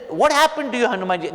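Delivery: high-pitched, lightly acted concern; concerned questioning. Ram asks crying Hanuman what happened, lightly acted concern. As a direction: start high and fall on the name.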